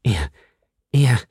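halting, shocked repetition; mildly stunned stammer. A narrator's reading. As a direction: stumble on the word, pausing between. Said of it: "stammelte sie".

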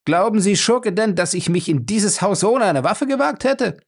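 indignant yelling at the villain; shouting. A narrator's reading. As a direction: throw the insult in his face. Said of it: "schrie Lacey ihn an".

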